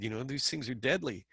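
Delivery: usual speaking voice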